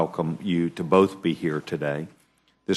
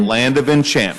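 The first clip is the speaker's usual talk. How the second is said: much higher and louder, booming it out; a jovial chairman calling out New Mexico's nickname; playful emphatic repeat; 8 semitones higher, 8 dB louder than usual. Real, not acted. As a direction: proclaim it like a grand title with a grin.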